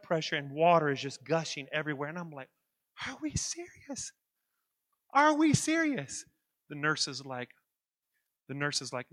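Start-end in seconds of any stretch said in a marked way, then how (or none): 3.1-4.1 high, slow stage whisper; hushed disbelief. Retold or imitated. Disbelief as office floods. 5.1-6.2 high, loud and slow mock outrage; loud disbelief. Repeats disbelief at flooding.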